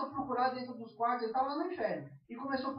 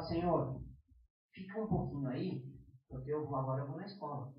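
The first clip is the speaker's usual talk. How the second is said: low, soft aside to God; acted casual prayer, dropped low and soft; pretend furtive prayer; 8 semitones lower, 6 dB quieter than usual. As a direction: quietly ask God to stay behind. Pretend aside asking God to wait.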